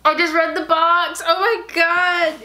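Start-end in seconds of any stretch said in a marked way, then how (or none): 1.3-2.4 slow, high awed oh my god; awed gasp. Real, not acted. Awed to viewers reading the box. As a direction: breathe it out in awe. Reads box label, thrilled.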